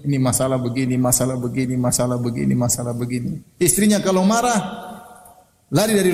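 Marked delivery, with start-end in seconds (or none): 0.0-3.4 low, monotone litany of complaints; complaining. Retold or imitated. Friend listing his troubles to the preacher, droning complaint. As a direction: list grievances in a repetitive drone.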